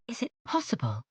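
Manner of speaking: high, hushed disbelief; astonished cry. Acted. Elizabeth after finishing the letter, stunned disbelief. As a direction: gasp out the question in wonder. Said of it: "cried Elizabeth".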